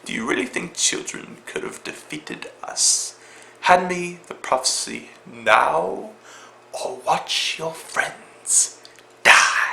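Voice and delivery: hushed, slow, breathy villain threat; cold villain whispering a demand; Malfoy's menacing villain voice. Retold or imitated. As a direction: lean in and threaten in a near-whisper. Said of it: "He's like".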